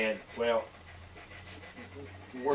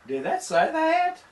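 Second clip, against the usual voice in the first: surprised; 4 dB louder than usual. Real, not acted. Spirit box seems to answer.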